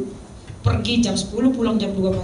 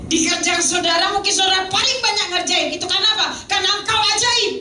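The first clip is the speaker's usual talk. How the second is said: high, insistent exhortation; rising, fervent pulpit cry; impassioned preaching; 11 semitones higher, 3 dB louder than usual. Real, not acted. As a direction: drive it home to the congregation. Exhorts congregation at high pitch.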